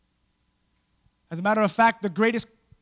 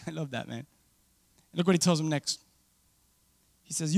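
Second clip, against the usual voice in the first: ordinary talk, nothing marked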